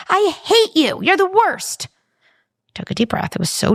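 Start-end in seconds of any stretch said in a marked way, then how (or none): 0.1-1.9 high, louder mock outburst; imitating a kid yelling I hate you; kid's angry yell. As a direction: yell it like an upset child. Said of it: "kid yelled".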